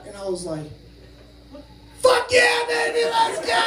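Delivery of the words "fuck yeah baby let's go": excited celebration. Retold or imitated. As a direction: re-enact a jubilant winner's whoop. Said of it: "I'm all excited".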